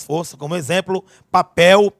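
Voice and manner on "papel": "papel" with the last syllable PEL hit louder; stress on PEL. Shown as a demonstration. Teacher giving an example of tonic syllable, stress on PEL in "papel". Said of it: "o pel aí como sílaba tônica".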